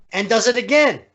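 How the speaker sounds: ordinary talk, nothing marked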